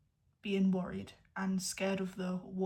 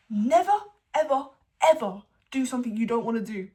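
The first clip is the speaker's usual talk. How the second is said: emphatic; 9 dB louder than usual. Real, not acted. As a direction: hammer home the advice. Emphatic advice.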